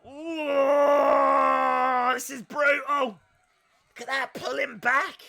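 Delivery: high, loud, thrilled yell at a brutal move; excited commentary shout. Real, not acted. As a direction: call the spot like you can't believe it. Live wrestling commentary, brutal spot.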